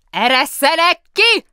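very loud, high, slow roar; yelled from the cell. A narrator's reading. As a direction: bellow through the cell door. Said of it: "ordította most a fogdából Kask".

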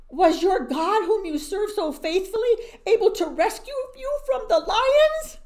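high, loud pleading call down the pit; king calling to Daniel in the den; anxious yell. Acted. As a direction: shout your hope down into the dark. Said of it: "he yelled down".

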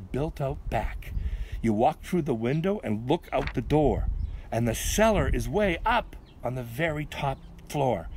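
measured, sing-song verse reading; poem recital. A narrator's reading. Reader telling kids of Backward Bill, rhythmic recital.

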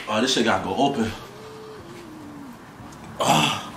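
strained, eyes burning from spice. Real, not acted. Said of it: "My eyes are burning".

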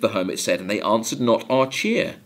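usual speaking voice

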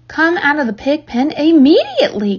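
high, cross mother's command; cried order. A narrator's reading. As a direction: order a child out with exasperation. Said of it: "cried Missus Arable".

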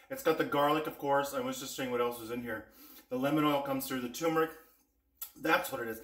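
ordinary talk

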